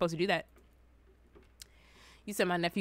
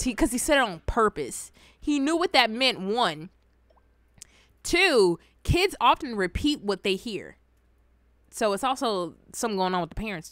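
usual speaking voice

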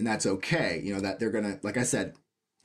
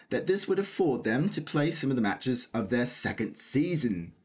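put-on British accent. Reading a cricket article aloud for fun, in a put-on British accent. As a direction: read it out like a British commentator. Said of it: "I said that in a British accent".

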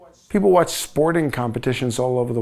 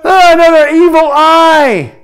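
a much higher, louder hammed-up exclamation; mock dramatic cry; 13 semitones higher, 11 dB louder than usual. Lecturer reacting to a photo of an inflamed eye, hammed-up cry. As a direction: shout it like a horror reveal.

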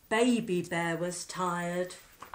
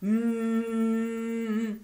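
fridge hum; 4 dB louder than usual. A narrator's reading. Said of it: "went the refrigerator".

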